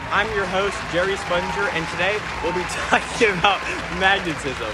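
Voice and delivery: upbeat, high TV host voice; bright curious science host. Acted. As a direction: sell it like a kids' show host. Kid hosting a pretend TV show.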